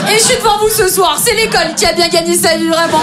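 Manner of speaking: high, shouted mock triumph; exclaimed punchline. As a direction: cry it out to the whole room. Punchline called out to the crowd.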